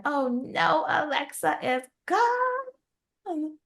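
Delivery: high, drawn-out melodramatic lament; hammed-up wail, stretching the last word long; mock dismay. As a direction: play the shock for comedy, high and slow. Mock-dramatic retelling of waking alone.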